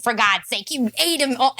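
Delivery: ordinary delivery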